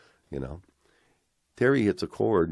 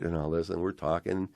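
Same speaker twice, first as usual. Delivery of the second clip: ordinary delivery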